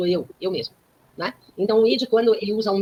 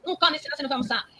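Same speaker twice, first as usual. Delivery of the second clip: mother scolding; 8 semitones higher, 4 dB quieter, much faster than usual. Retold or imitated. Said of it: "Ela não é".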